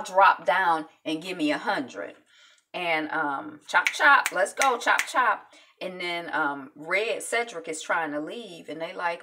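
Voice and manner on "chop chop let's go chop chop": high, loud barking of orders; mock drill command. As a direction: hurry them like a drill sergeant. Drill coach hurrying push-ups.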